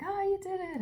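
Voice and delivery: high excited cheer; a playful squeal of victory; mock celebration. Celebrating character quote.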